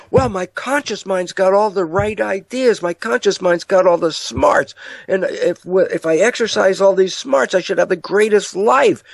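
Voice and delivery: high, earnest mock boasting; naive confidence. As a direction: play someone pleased with their own cleverness. Acting a confident, naive self-believer.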